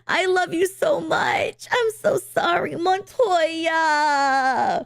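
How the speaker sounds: high, slow, wailing mock plea; mock tearful apology. Girlfriend runs over, hammed-up tearful plea to Montoya. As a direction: overplay a sobbing girlfriend begging. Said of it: "Comes over and goes".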